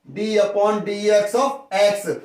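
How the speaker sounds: spelled out very slowly for notes; teacher dictating at writing speed; slow dictation. Shown as a demonstration. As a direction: say it slow enough to write down.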